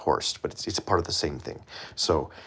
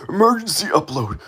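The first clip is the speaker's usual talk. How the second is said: excited; 11 semitones higher, 5 dB louder than usual. Real, not acted. Announcing emergency upload.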